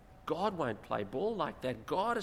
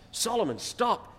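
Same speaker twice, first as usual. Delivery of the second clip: raised, higher dad voice, acted out; father scolding; 7 semitones higher, 4 dB louder than usual. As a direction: play a dad ordering his son to stop. Father repeats scolding to son.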